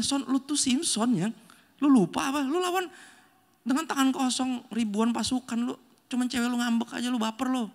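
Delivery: teasing friend. Retold or imitated. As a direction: needle him like an incredulous buddy. Friend scolding Samson.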